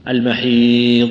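المحيض with the ض drawn out long and loud; demonstrating istitala by prolonging ض; elongated dad. Shown as a demonstration. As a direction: stretch the ض in المحيض. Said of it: "الاستطالة وهو امتداد الصوت".

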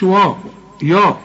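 "وا، يا" thick and dark, rounded towards "waw, yaw"; wrong heavy vowels. Shown as a demonstration. Teacher warning what not to say, heavy vowels. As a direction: darken both vowels in "وا، يا". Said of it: "ما تقولش".

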